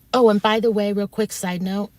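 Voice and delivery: ordinary talk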